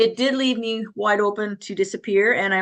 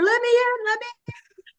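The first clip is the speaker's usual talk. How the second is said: high, frantic pleading cry; desperate pleading; 13 semitones higher than usual. Retold or imitated. Nurse pounding on the locked psych unit door, high frantic plea.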